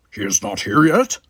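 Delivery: gruff Klingon officer asking; Worf, puzzled and stern; Worf's voice. Acted. Worf voiced, far timbre.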